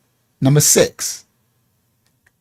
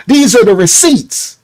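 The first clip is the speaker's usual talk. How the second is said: getting loud; 7 semitones higher, 4 dB louder than usual. Real, not acted. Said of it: "Yeah, I'm gonna get loud".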